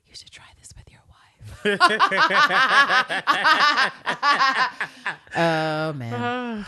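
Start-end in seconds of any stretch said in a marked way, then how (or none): 0.1-1.8 whispered taunt; intimate, needling whisper; close-up whisper. Retold or imitated. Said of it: "she gets real close". 1.8-5.3 high, loud peals of laughter; real uncontrolled laughing fit. Real, not acted. Laughing at the whispered line.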